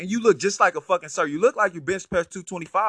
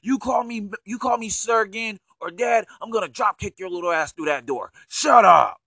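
loud, higher mock rage at the son; pretend furious parent; 7 semitones higher, 7 dB louder than usual. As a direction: blow up at the kid, hammed-up. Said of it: "what do you say to your son when he says".

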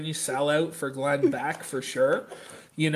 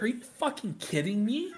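higher, softer imitation of indignant disbelief; mock incredulous outburst; 4 semitones higher, 5 dB quieter than usual. Co-host imitating the man begged for change, mock incredulous. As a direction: squeak out a mock-offended question. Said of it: "He's like".

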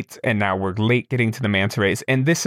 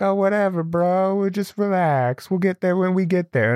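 higher, dopey carefree imitation; mock laid-back bro; 8 semitones higher than usual. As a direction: play the chill friend who doesn't care about time. Friends shrugging off being late.

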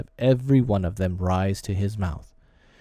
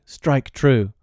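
resolute, higher-pitched urging; firm command; 4 semitones higher, 4 dB louder than usual. A narrator's reading. Tootles accepting his punishment, firm. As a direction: urge it firmly, without flinching. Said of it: "he said firmly".

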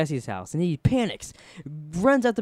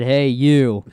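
loud called-out hey you; retold biker call; 12 dB louder than usual. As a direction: call out across the street.

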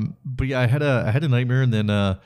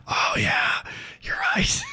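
whisper; 3 dB quieter, slower than usual. Retold or imitated. Whispered realization, breaking into laughter.

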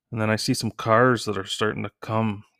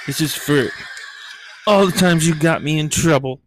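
higher, laughing threat; gleeful revenge; 7 semitones higher, 4 dB louder than usual. Retold or imitated. Daughter gloating while torturing her zombie dad, laughing. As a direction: relish the payback with a laugh.

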